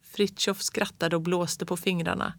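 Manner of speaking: ordinary delivery, nothing marked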